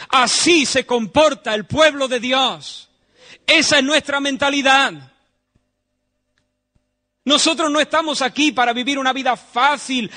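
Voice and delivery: loud, high preaching, slowing on key words; fiery preaching. Acted. Preacher charging his congregation to live holy. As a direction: drive the message home from the pulpit.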